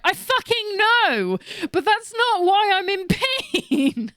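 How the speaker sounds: high, drawn-out exasperated protest; exasperated retort. Retold or imitated. Patient answering doctors who blame her weight, exasperated.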